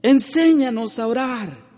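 high, loud earnest request; disciples' plea. Retold or imitated. Preacher voicing disciples asking Jesus, high earnest plea. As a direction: ask to be taught, full-voiced. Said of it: "Le decían los discípulos".

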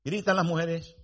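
ordinary delivery

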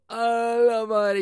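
high, loud, slow mock gasp of disbelief; blown-away amazement, stretched out; stunned exclamation. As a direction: draw out a shocked ¡a la madre!. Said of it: "Y yo".

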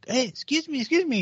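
high voice calling politely; mock woman calling out. Woman in a car calling to a dog walker, high.

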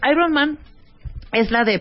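talking while chewing a peanut; mouth full. Real, not acted. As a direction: speak round a mouthful. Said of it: "me estoy comiendo un cacahuate".

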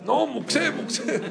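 high, laughing "No, muxé, muxé"; the rabbi laughing as he refuses the keys, high and amused; laughing protest. Retold or imitated.

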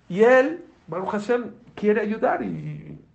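very high, loud, drawn-out praise; hammed-up amazement at his eagerness; mock exclamation. Mock exclamation about the helper.

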